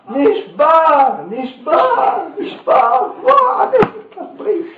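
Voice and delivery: hammed-up wailing rabbi mourning his broken jug; wailing shout. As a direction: howl the word נשבר again and again like a heartbroken old man. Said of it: "התחיל לצעוק, וצעק".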